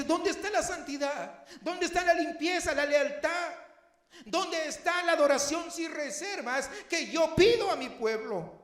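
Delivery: loud, high, ringing rebuke from God; booming divine reproach; God's demanding voice. Acted. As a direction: voice God demanding loyalty from his people.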